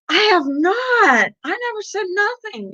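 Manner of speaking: play-acted high-pitched self-defense; mock indignant denial. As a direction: imitate a defensive squeal of innocence. Acted defensive reply to false accusation.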